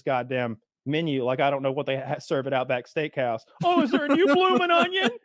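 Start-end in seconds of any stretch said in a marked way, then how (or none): 3.6-5.2 loud, high-pitched fake delight; mock excitement. Bored diner pretending to marvel at the menu, mock excitement. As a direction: gush sarcastically in a high silly voice.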